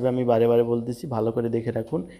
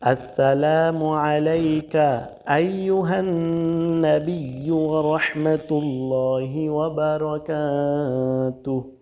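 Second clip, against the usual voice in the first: drawn-out Arabic recitation, higher and very slow; 3 semitones higher, much slower than usual. Shown as a demonstration. Teacher leads the full tashahhud for learners to repeat. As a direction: chant it slowly for students to follow. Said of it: "আমার সাথে আপনারাও একটু বলুন".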